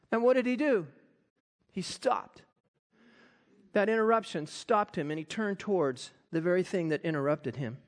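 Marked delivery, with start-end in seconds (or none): none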